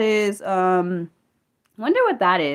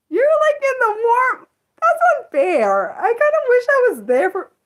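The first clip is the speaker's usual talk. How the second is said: excited envy; 5 dB louder than usual. Real, not acted. Freezing streamer to warm viewer, excitedly envious. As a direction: pitch it way up, wishing you were there.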